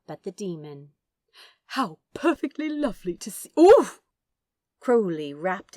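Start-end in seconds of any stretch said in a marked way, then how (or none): 1.7-3.5 high, fast, fluttery gush of welcome; delighted gush. A narrator's reading. Angel greets the demon, high and fast with delight. Aziraphale's greeting cut off by hug. 3.6-4.0 a high, loud winded oof; the air squashed out by a hug; squeezed oof. A narrator's reading. Angel grabbed in a tight hug, a loud winded oof.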